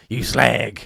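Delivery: lightly voiced rough London geezer; a hint of rough Cockney; Sweeney-style rough. Alfred insulting his boss, lightly roughened London accent. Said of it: "like, Sweeney rough".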